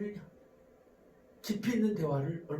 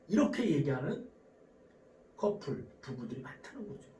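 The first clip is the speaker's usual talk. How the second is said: ordinary delivery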